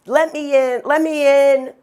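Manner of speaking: desperate pleading. Retold or imitated. Banging on the closed vet door.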